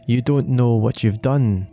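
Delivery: usual speaking voice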